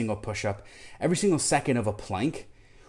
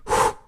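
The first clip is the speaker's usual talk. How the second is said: puffing out hard; tired exhale; 4 dB louder than usual. Real, not acted. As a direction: blow out from effort. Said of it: "this plank's getting to me".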